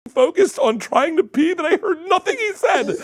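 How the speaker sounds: high, loud, laughing through words. Real, not acted. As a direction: squeal it while cracking up.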